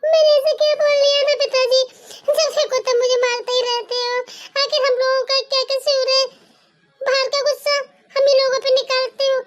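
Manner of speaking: put-on a girl asking her father what she did wrong; hurt daughter.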